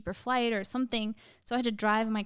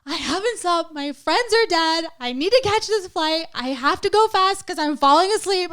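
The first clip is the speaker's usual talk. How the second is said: higher, louder tearful pleading, imitating; teary mock plea, pitched up; retold sobbing; 9 semitones higher, 10 dB louder than usual. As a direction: reenact bawling to the cop, high and loud. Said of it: "And I just start crying".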